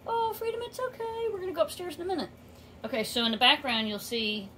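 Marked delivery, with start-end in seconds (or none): 0.1-2.3 much higher, sing-song coo to a pet bird; cooing pet talk. Real, not acted. Owner soothing her parrot about going upstairs, in high cooing pet talk.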